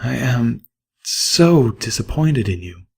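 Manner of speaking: soft, slow, regretful mare; softened with regret. A narrator's reading. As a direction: let it fall quietly in sorrow. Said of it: "her voice softening with regret".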